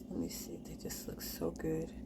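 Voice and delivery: soft whisper. Real, not acted. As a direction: whisper it to yourself. Whispering over the bread.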